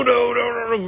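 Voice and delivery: high-pitched protest; high panic. Acted. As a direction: squeal out the protest. Panicked drunk skit.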